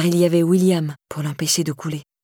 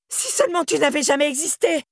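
hysterical screaming; 9 semitones higher than usual. A narrator's reading. Wife screams during quarrel.

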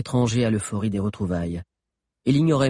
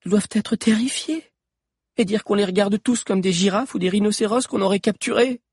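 put-on higher girl's voice, worried; pitying girl; 10 semitones higher than usual. A narrator's reading. Sabi worries about the frightened prisoners, higher and pitying. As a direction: voice her pity as a young girl.

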